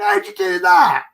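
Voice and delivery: high, loud, astonished; amazed question. Retold or imitated. The co-driver marvels at the camera trick, high and loud. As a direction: squeal it in disbelief.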